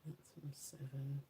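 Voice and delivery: a soft whisper to herself; whispered aside. Real, not acted. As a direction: breathe it out as a private note. Murmurs while swatching alone.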